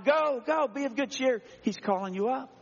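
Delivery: high, eager urging; excited crowd urging someone forward; cheering him on. Acted. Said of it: "are now cheering him on".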